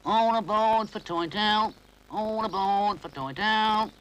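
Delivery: slow, high, loud station call; drawn-out guard's announcement. Acted. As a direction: train guard calling passengers aboard. Train guard calling out.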